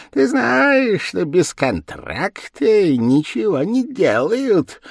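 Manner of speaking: high, slow wheedling demon voice; devil's high-pitched drawl; squeaky devil. A narrator's reading. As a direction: squeak out the terms of the bargain. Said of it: "пропищал чёрт".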